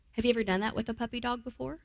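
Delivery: ordinary delivery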